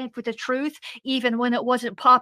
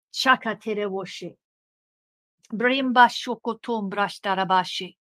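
speaking in tongues; 3 semitones lower than usual. Real, not acted. Glossolalia inside fervent prayer.

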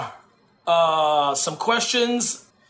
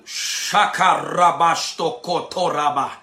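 lower, murmured tongues burst, unlike his own voice; speaking in tongues; 3 semitones lower, slower than usual. Real, not acted. Preacher breaks into tongues mid-reading, murmured low. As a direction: let a stream of tongues roll out. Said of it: "I had to release that".

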